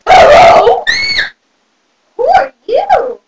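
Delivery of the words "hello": loud, high hello; calling out. Acted. Bunny fairy arriving at child's bedtime, calling out. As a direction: call out sharply, pitched up.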